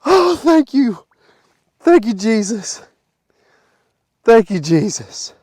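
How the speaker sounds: loud, high cheering; ecstatic shouting. Real, not acted. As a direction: yell for joy. Finds his lost disc.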